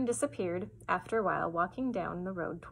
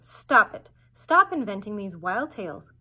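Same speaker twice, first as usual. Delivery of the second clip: scolding; 9 dB louder, slower than usual. Acted. Mother scolding Fern's tales.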